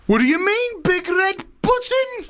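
very high, alarmed comic dictator; Putin. Acted. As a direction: stretch the question out high in mock alarm. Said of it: "Okay, I'll be Putin then".

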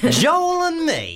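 very high, drawn-out camp Leeds voice; hammed-up squeal of a fake Leeds accent; camper Leeds accent. Host restarts the dilemma, extra camp Leeds accent. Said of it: "start again and make it more camp".

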